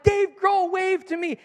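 high, loud and slow; giddy disbelief; thrilled fan gushing; starstruck. Retold or imitated. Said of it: "I was like".

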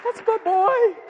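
high, loud burst of fatherly pride; overcome with emotion. Retold or imitated. Proud dad watching his son coach his sister, overcome with emotion. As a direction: cry it out, hammed-up and overwhelmed. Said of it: "filled with all this emotion".

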